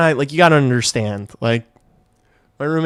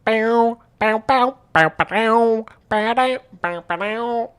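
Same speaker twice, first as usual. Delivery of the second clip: 1 silly bow chant; 9 semitones higher, slower than usual. Pretend. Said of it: "what is wrong with me?".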